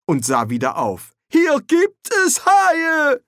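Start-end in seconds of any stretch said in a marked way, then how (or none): 1.3-3.2 much higher, louder, slow alarmed cry; Ponder crying out. A narrator's reading. Ponder sees sharks below and cries out, slow and high. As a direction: shout it as you spot them. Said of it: "rief er".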